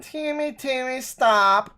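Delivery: high-pitched imitation of the mom scolding; lightly mocking mom with an accent. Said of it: "is that an accent?".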